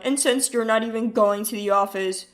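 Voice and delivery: smug husband, offended and defensive. Acted. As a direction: snap back like a proud husband. Husband berates wife.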